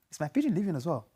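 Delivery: ordinary delivery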